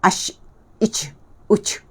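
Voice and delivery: "ash, ich, uch" ending in hiss; not jim. Shown as a demonstration. Teacher shows another mistake, hissed "ash, ich, uch". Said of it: "Itu tidak bunyi jim".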